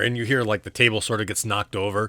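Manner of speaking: ordinary delivery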